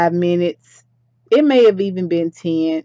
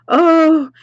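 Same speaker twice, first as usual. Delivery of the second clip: frantic; 9 semitones higher, 5 dB louder than usual. Acted. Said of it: "She's frantic".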